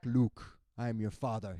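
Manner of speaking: lightly done Darth Vader; mild Darth Vader. Retold or imitated. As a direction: intone it like Vader. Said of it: "The Empire Strikes Back".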